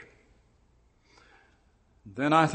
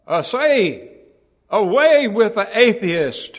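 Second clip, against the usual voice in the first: loud, higher, slow jeering shout; proconsul's yell; 6 semitones higher, 9 dB louder, slower than usual. Retold or imitated. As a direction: jeer from the stands like a Roman official. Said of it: "the proconsul from the stand yelled".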